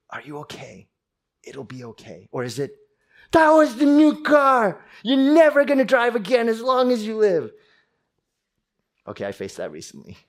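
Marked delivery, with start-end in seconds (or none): 0.1-2.3 much softer, tender and calm; gentle reassurance. Retold or imitated. Parent comforting a child after a car accident, soft and reassuring. 3.3-7.5 high, loud shouting outburst; mock furious parent. Parent yelling at a child who crashed the new car, furious.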